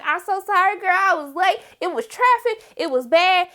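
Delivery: high, apologetic gush; high breathless apology; flustered girl. Retold or imitated. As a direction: rush through the excuses. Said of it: "this girl come in like".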